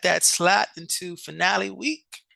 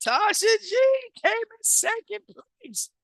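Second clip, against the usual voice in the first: excited hype; 13 semitones higher than usual. Real, not acted. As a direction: sing out the placing. Hyping up the winner.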